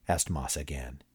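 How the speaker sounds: ordinary delivery, nothing marked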